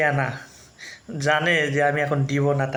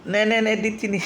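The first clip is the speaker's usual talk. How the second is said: coaxing pet talk; 5 semitones higher than usual. Real, not acted. Coaxing sulking cat with food.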